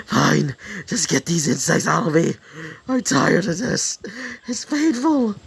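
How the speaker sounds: pained surrender. Acted. Prisoner gives in, in pain.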